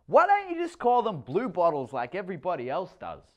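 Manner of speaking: high, loud exasperated retort; annoyed teen. A narrator's reading. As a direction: roll your eyes at him. Stepdaughter annoyed.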